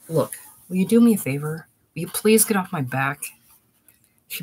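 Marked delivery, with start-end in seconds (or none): 0.1-3.2 irritated. Acted. Husband snaps at wife in quarrel.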